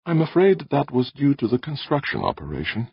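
high, apologetic admission; shamefaced. A narrator's reading. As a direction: admit it with mild embarrassment.

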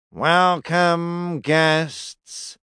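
slow, even, droning priest; flat monotone voice. Acted. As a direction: intone it without any feeling. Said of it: "said a flat, monotone male voice".

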